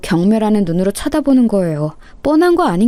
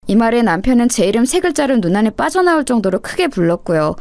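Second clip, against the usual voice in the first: plain reading voice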